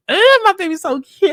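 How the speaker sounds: high-pitched doting squeal; gushing. Real, not acted. Speaker swoons over the camera operator, high gushing. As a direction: gush over an adored crush, high-pitched.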